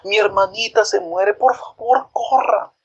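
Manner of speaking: put-on a boy begs the doctor to run; high breathless plea; shouting for the doctor. A narrator's reading. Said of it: "gritó por el médico".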